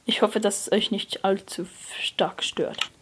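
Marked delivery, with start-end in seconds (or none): none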